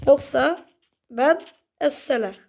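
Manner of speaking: ordinary talk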